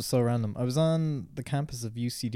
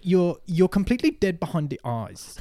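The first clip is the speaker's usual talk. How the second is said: put-on higher South African accent; 8 semitones higher, 5 dB louder than usual. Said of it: "great South African accent you got there".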